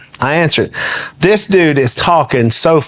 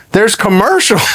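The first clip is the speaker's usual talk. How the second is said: slightly raised, emphatic announcement; matter-of-fact reveal; 4 semitones higher than usual. Retold or imitated. Caller reporting the endorsement ads, retold emphatic reveal. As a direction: break the news as a statement.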